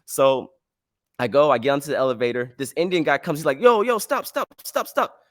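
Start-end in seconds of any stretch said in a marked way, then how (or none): 3.6-5.2 high, rapid imitated calls; urgent calling out. Man running for the elevator, imitated urgent high call. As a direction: mimic someone yelling to catch the lift.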